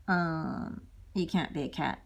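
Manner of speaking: low, patient father frog; a hint of a deep dad; lightly voiced daddy frog. Daddy frog answers son in a low fatherly voice.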